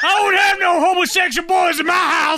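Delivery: mock scolding parent. Imitating his parents, mock scolding. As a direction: play the shocked parent.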